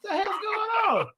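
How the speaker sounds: high, incredulous mock protest; acting out baffled protest; high-pitched disbelief. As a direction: squeal your disbelief in a high voice. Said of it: "I said".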